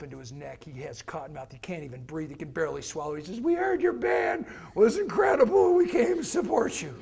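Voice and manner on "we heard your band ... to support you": nervous teacher. Retold or imitated. Nervous teacher to biker band.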